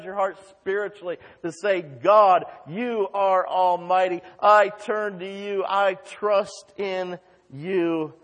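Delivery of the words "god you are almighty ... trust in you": prayer. Real, not acted. Leading a prayer to God.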